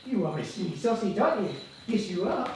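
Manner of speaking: soft, singsong pet talk; doting dog talk. Real, not acted. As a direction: fuss softly over a dog. Fussing over the dog.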